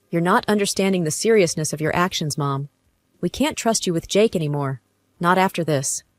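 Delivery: usual speaking voice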